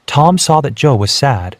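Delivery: plain reading voice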